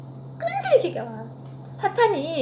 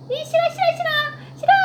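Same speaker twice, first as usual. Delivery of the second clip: high whiny child's refusal, mildly played; mock tantrum; 13 semitones higher than usual. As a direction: act out a sulky kid saying no. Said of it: "이렇게 화내". (떼쓰는 아이처럼 높게 칭얼대는 싫어)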